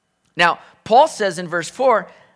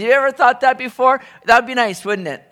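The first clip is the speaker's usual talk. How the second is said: ordinary delivery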